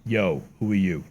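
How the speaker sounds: different Donald voice. Acted. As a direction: play Donald as someone else entirely. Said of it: "have it just something completely different".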